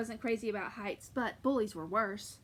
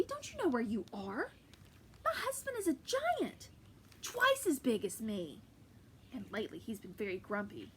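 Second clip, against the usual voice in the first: giant woman. Acted. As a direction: warn the boy in a big voice. Giantess warns Jack.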